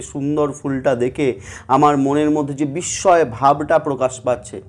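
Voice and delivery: ordinary talk, nothing marked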